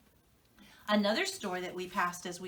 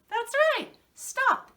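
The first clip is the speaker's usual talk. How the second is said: much higher and louder, a bright cheer; excited reveal; 14 semitones higher, 7 dB louder than usual. Shown as a demonstration. Teacher cheering the right answer to young viewers. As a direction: announce it like the kids just won. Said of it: "Do you know what that sign said?".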